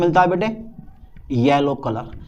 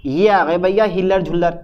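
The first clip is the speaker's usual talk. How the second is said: ordinary delivery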